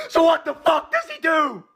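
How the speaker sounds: high furious yell; angry shout. Real, not acted. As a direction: bellow the question in frustration. Ranting about a bad player.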